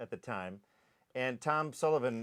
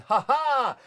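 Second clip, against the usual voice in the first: high, loud joyful laugh; gleeful whoop; 11 semitones higher, 9 dB louder than usual. Retold or imitated. Running down the hill after the scene, loud whoop. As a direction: whoop while running downhill.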